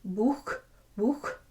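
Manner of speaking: buch ending in a throaty ch; German buch. Shown as a demonstration. Teacher modelling German buch. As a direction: say buch the German way, rasped at the end. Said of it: "or German".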